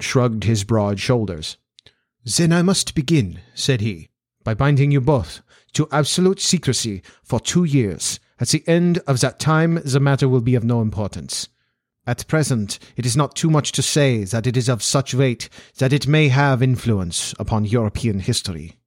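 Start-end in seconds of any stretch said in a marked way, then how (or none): 2.2-3.4 put-on formal Central European-accented nobleman; accented aristocrat stating his terms; Bohemian Count. Voices the Count as a character. 4.4-18.8 put-on formal Central European-accented nobleman; accented aristocrat stating his terms; Bohemian Count. Voices the Count as a character.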